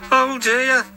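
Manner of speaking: high, sad little engine voice; a gloomy high-pitched engine sighing. Acted. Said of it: "he thought sadly".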